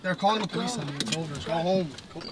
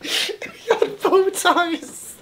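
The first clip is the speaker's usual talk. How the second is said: laughing while talking; 8 semitones higher, much slower than usual. Real, not acted. Laughing while greeting in bow ties.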